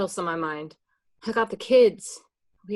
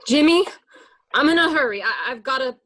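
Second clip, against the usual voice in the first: higher, louder call; 5 semitones higher, 7 dB louder, faster than usual. Acted. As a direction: call back to him as you hurry off. Karen calling out, backing off.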